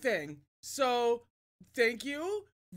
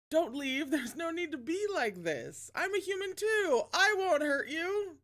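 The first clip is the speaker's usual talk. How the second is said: higher, coaxing monster voice; creepy Mudface; 7 semitones higher than usual. Acted. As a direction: sweet-talk them like a lurking monster. The Mudface pleads.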